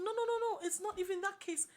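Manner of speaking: very high, rapid-fire refusal; retold insistent denial. Lecturer overruling a student's objection, insistent. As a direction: cut in with high mock protest.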